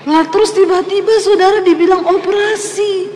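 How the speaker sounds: amused disbelief, laughing. Real, not acted. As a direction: laugh at the sudden news. Recalling the shock, laughing.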